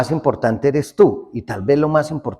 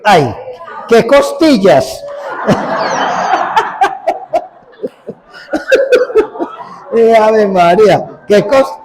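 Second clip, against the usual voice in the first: laughing mock admiration; 8 semitones higher, 4 dB louder than usual.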